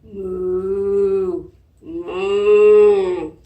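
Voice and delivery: low drawn-out moo; cheerful cow moo for preschoolers. Shown as a demonstration. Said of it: "let me hear you moo".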